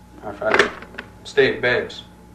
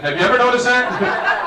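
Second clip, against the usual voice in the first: normal talking voice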